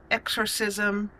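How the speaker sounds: ordinary delivery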